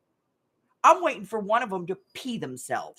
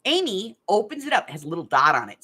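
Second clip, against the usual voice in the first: normal talking voice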